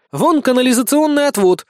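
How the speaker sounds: excited exclamation, high and loud; восклицание. A narrator's reading. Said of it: "воскликнула Безос". (возбуждённое восклицание)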